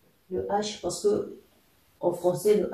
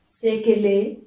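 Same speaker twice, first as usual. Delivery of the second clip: SEKELE louder, rising on the final syllable; question intonation; 3 semitones higher, 7 dB louder than usual. Shown as a demonstration. Teacher showing learners the question "sekele", rising intonation. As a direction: ask "sekele" with a lifted ending. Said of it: "c'est une question".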